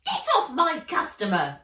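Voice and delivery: loud, high screeching elf. Acted. Elf driving the old man off, screeching. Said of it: "screeched the elf".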